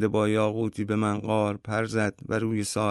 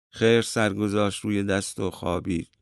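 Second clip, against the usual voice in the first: ordinary delivery, nothing marked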